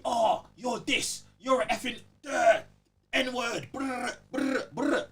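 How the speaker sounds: high, mocking imitation of a raging gamer; mocking lobby abuser. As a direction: sneer it like an online troll. Retelling abusive lobby players.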